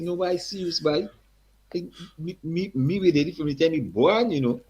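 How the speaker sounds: put-on high, hearty Guyanese accent. Eddie's father greets the young singer in a high Guyanese accent. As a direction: greet him like an old Guyanese man. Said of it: "Strong accent again".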